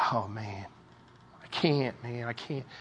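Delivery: low, very soft, worn-out groaning words; weary and defeated. Acted. Preacher voices someone with no might left, weary and defeated. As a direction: give up softly, barely any strength left.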